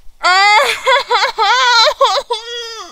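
high, wavering real sob; emotional crying. Real, not acted. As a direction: let out a long high wail that trembles. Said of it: "muy, muy emocionada". (sollozo agudo y tembloroso de emoción)

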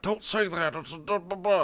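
high, loud gabbled imitation of objectors; mock scolding. People objecting to his joke, high mock scolding. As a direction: mimic offended people, high and babbling. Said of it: "and people are like".

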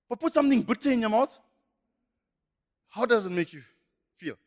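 ordinary talk, nothing marked

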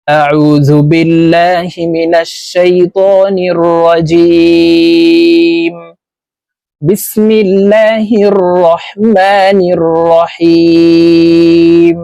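slow, drawn-out chanted recitation; Quranic recitation. Acted. Teacher opening the lesson with a slow recited invocation. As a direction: intone it slowly like Quran recitation.

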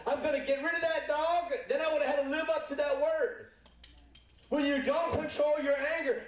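high-pitched, driving testimony; urgent pulpit delivery; impassioned preaching. Real, not acted. Preaches at full force.

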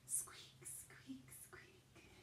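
tiny unvoiced mouse squeaks; whispered squeaks. Shown as a demonstration. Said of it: "What does a mouse say?".

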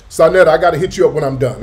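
fast and loud, calling across to Sonetta; loud hurried call to a friend nearby. Real, not acted. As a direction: shout it quickly so she hears you from a distance.

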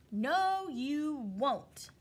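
loud, sharp no from the hen; a stern hen's refusal; firm refusal. Acted. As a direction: shut them down hard.

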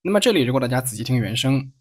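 usual speaking voice

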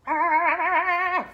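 loud mock barking. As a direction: bark it out like a playful pup. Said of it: "That's not a good dog impression".

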